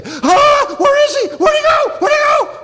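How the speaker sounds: put-on high frantic shouting for a lost child; panicked shout. Parents searching for lost Jesus, frantic high shout. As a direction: scream it like a terrified parent.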